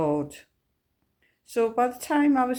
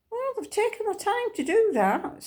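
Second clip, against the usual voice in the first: thought voiced much higher, lightly impressed; pleased surprise; 13 semitones higher than usual. Recalling her thought about the church leaflet, lightly amazed and much higher. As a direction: echo her own pleasant surprise in a lifted voice.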